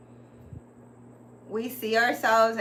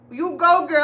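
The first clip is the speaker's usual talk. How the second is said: put-on louder, higher you go girl; hyped cheer; 6 semitones higher, 8 dB louder than usual. Talking to herself, cheering on the girl, loud and high. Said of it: "I was like".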